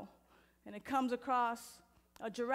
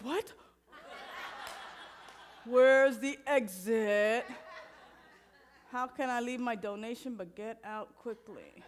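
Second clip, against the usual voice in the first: hammed-up nervous newcomer hunting for the exit; panicked congregant; 4 dB louder than usual. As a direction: play a churchgoer desperate to bolt. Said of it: "and some of you are like".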